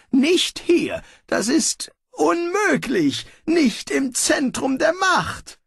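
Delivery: high, tense voice of a small creature; a little figure protesting in disbelief; shocked disbelief, high pitched; high startled character voice. Acted.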